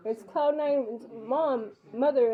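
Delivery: slowly spelled-out self-introduction; slow careful announcing. Retold or imitated. Mother repeating who she is to the intercom, slowly. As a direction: drag each word so the intercom hears.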